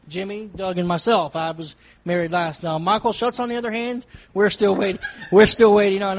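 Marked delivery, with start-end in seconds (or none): 4.3-6.2 laughing. Real, not acted. Laughs about friend still single.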